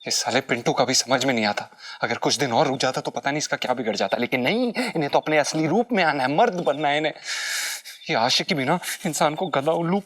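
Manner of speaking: low-pitched complaint to himself; exasperated grumbling. Acted. Alone after the quarrel, complaining about Pintu, exasperated grumbling. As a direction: grouse to yourself about a reckless partner.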